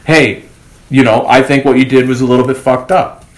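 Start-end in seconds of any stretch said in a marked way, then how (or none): none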